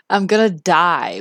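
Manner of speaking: ordinary talk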